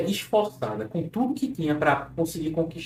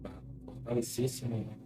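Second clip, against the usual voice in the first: guided hypnosis; 2 semitones lower, 9 dB quieter than usual. Acted. Leads a clinical hypnosis session.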